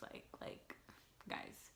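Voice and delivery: whispered. Real, not acted. Intimate aside to viewers.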